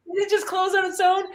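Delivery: high, sarcastic disbelief; mock incredulous. Dad teasing his daughter with a mock-suspicious high voice. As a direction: play along with an exaggerated, suspicious high pitch. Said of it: "And I was like".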